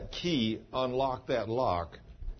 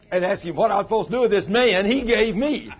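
loud, higher, laughing; laughing through a retold quote; 9 semitones higher, 9 dB louder than usual. Pastor retells Cheryl's confession, laughing loudly. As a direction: laugh while quoting her. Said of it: "she said".

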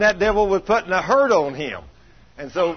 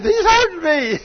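high, loud laughing squeal; laughing quote; 14 semitones higher, 7 dB louder than usual. Retold or imitated. Preacher quoting Jennifer after a light pinch, high and laughing.

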